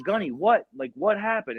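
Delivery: high-pitched retold questioning; alarmed questioning. Assistant asks gunny what happened, alarmed questioning.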